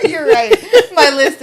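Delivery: laughing while joking. Real, not acted. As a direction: talk through laughter.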